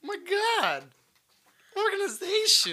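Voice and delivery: very high, squeaky exclaim; mock amazement. Mock shock at agenda.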